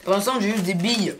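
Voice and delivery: talking round hard candy; words through a mouthful of candy; mouth full. Real, not acted. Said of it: "on parle la bouche pleine".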